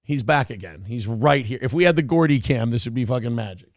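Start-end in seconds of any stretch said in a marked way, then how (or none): none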